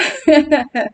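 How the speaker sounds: short amused chuckle; real little laugh to oneself. Real, not acted. Recalling what the Lord answered, laughing. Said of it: "Yo me río porque".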